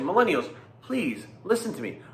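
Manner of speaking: usual speaking voice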